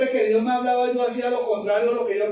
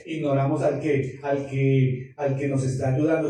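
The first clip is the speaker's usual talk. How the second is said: usual speaking voice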